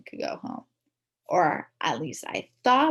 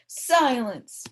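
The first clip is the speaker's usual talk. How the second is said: hollered; 2 semitones higher, 5 dB louder than usual. A narrator's reading. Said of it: "Mister Kluntz hollered".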